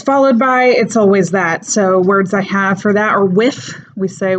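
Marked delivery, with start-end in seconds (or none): 3.2-3.7 "with" pronounced wiff. Shown as a demonstration. Teacher showing the unvoiced TH, "with" ending in F. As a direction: end "with" on an F sound. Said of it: "comes out as an F".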